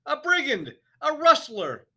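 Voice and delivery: very high, very loud scream; shrieking headmistress; screamed accusation. A narrator's reading. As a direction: scream it at him. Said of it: "the Trunchbull screamed".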